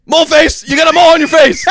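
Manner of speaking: high-pitched mock angry shout; mad outburst. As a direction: yell it like a drunk friend lashing out. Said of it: "finally he's getting mad at her".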